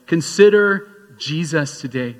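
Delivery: Consider drawn out slowly, loud; slow emphatic plea. Acted. Pastor urging the congregation, slow and emphatic. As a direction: press the word Consider slowly. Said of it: "I'm signing like I'm talking".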